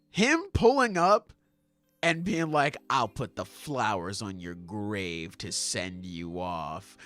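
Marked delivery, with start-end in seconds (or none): 2.9-7.0 low, quiet and menacing; cold threat. Acted. Rayleigh taunting Garp, low quiet menace. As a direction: Rayleigh promising flowers for the grave, low and cold.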